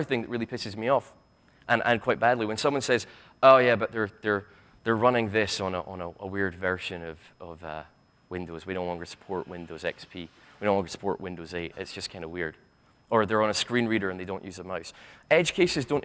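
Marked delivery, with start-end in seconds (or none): 3.4-12.6 low, trailing-off excuse; mock developer shrugging off a problem; dismissive excuse.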